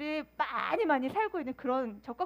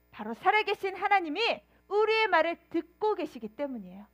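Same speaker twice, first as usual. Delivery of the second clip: ordinary talk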